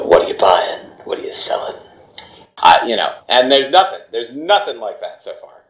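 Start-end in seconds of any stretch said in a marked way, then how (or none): 0.0-1.8 low whispered merchant voice; hushed dark shopkeeper; deep voice. Pretend. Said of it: "my voice got deep".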